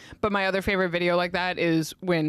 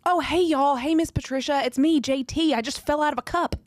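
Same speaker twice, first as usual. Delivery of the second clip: Southern reality-star voice; 7 semitones higher than usual. Retold or imitated. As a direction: gush like a reality star, all y'all. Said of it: "it's more like".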